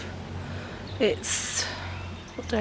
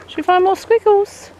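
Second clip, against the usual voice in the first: high, louder pet talk; coaxing the dog; 10 semitones higher, 6 dB louder than usual. Real, not acted. Owner invites dog to find squirrels, high coaxing voice. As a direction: coax your dog brightly in a raised voice.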